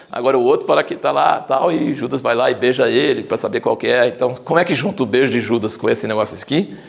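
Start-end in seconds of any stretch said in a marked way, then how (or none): none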